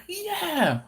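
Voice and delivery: high-pitched eager agreement; excited coworker. Retold or imitated. Coworker defending mushy peas, excited impression. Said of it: "his level of excitement for mushy".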